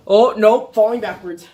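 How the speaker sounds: sudden loud startled cry while losing balance; startled yelp. Real, not acted. Nearly falling off air mattress.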